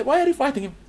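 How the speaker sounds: high-pitched, mock-puzzled question. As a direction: ask as a baffled onlooker. Acted questioning of a fighter.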